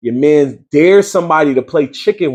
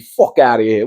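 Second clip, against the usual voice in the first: ordinary delivery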